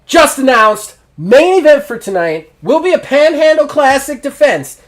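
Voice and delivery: high, loud ring announcement; announcer. Acted. Announcer hypes tonight's main event, high and loud.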